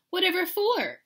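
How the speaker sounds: high, loud baffled question; baffled mama. A narrator's reading. Mama questions the girls, high and baffled.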